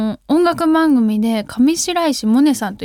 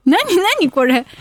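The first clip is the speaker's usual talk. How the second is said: ordinary talk, nothing marked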